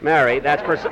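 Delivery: ordinary delivery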